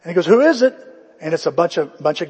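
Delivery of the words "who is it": loud, high call through the door; a mock call through the door. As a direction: shout to whoever is knocking. Lot calls through door.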